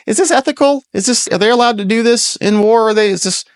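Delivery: high, whiny, put-on voice asking the questions; high mocking impression of the reporters. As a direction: squeal the questions like a pestering reporter. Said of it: "People say".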